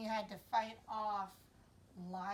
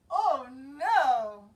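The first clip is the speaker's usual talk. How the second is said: loud, dismayed cry; dismayed David; 14 dB louder than usual. Acted. David groans under the heavy armor, loud dismay. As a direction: wail it in alarm. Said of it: "says David".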